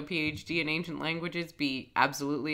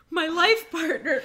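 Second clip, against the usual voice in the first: laughing mock lament; 11 semitones higher, 10 dB louder than usual.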